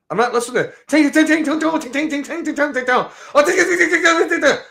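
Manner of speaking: high, sing-song mock chatter. Ranting about loud phone talk on buses, high mocking singsong. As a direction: parody the noise in a high singsong. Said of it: "mocking foreign chatter".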